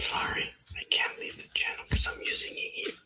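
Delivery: real unvoiced whisper, breathy and hushed. Real, not acted. Apologising to a friend on the call, in a whisper. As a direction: whisper it as if someone nearby might hear. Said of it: "Why am I whispering?".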